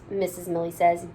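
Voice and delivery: ordinary delivery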